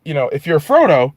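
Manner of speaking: normal talking voice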